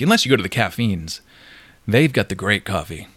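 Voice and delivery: usual speaking voice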